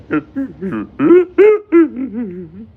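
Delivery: very high loud rising cackle; shrill laugh. Pretend. Cackle after whimper.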